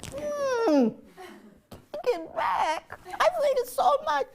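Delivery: very high, whiny mock crying; mock wail. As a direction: wail in exaggerated dismay at being told. Acting out own dismayed reaction.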